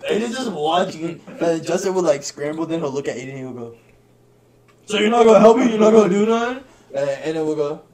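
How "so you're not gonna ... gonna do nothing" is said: loud, high-pitched outraged question; mock indignant appeal. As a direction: imitate a pinned friend demanding help. Imitates friend demanding help from onlooker.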